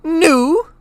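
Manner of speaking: shrill loud indignant shriek. A narrator's reading. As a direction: shriek it in outrage. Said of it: "shrieked Aunt Petunia".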